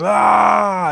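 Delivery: high, full-voiced "ah" yell; acted pained yell. Warrior hit in the back by bombs, loud high yell. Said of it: "as he yells".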